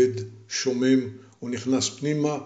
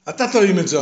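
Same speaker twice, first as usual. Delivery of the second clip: louder, stubborn back-talk; insistent; 5 semitones higher, 5 dB louder, faster than usual. Acted. Young man refusing the giant's order, stubbornly insistent. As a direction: toss the order right back.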